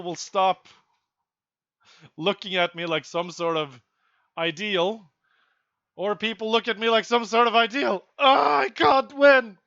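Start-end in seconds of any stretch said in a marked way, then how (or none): 6.0-9.6 mock wailing. Real, not acted. Vlogger lamenting his image, mock wailing. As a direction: wail like it's hopeless.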